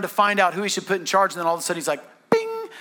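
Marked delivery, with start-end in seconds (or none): 2.3-2.7 high, held "bing"; idea chime. Acted. Pharaoh suddenly has an idea, a high chiming bing. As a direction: ring it out like a bell.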